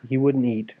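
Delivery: ordinary delivery, nothing marked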